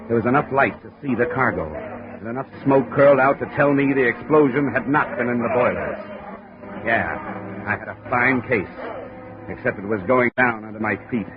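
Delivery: tense noir voice-over, building with the action; hard-boiled narration. A narrator's reading. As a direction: tell it like a weary detective looking back. Hard-boiled first-person narration over music.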